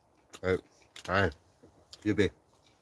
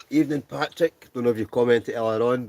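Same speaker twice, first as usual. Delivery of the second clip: excited greeting; 5 semitones higher, 7 dB louder than usual. Real, not acted. Greeting viewer loudly.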